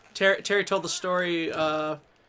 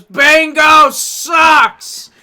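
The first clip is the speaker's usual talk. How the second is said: slow, loud, high bellow; angry shout; 8 semitones higher, 14 dB louder, much slower than usual. Retold or imitated. Furious wrestler yelling at the bingo crowd, loud and slow. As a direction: drag out an angry yell. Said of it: "Funk, pissed off, goes out and goes".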